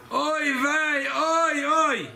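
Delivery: Oy, vey, oy, oy drawn out high like a moaning man; demo moan. Shown as a demonstration. Teacher shows the class how a suffering person moans, high groaning. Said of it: "when he moans".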